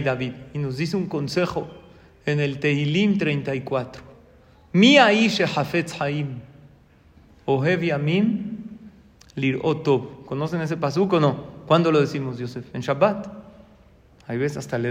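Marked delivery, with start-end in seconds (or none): 4.7-10.3 slow, measured reading of the verse; slow psalm recital. Shown as a demonstration. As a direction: recite it with reverence. Recita el salmo lentamente. (recitado lento y solemne)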